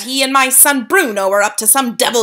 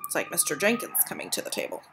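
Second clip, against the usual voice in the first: whispered aside; 3 semitones higher, 11 dB quieter, faster than usual. Real, not acted. Reader's aside, unvoiced.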